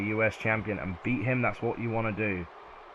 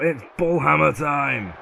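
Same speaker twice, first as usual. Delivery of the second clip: slower, louder build into a huge boom; hype shout; 6 semitones higher, 11 dB louder, much slower than usual. Real, not acted. Commentator calling the bull hammer, building to a shout. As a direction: bellow the finisher call.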